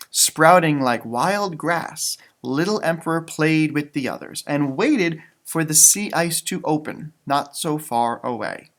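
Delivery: gentle read-aloud storytelling voice; story narration. Acted. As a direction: read it like a bedtime picture book. Reading picture book aloud.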